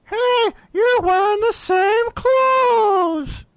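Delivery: high, slow silly clown voice. Acted. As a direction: squeal it like a goofy clown. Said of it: "That was my clown voice".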